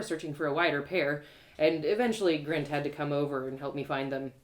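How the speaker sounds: plain reading voice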